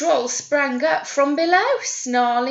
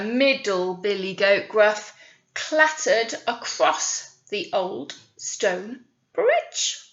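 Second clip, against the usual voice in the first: plain reading voice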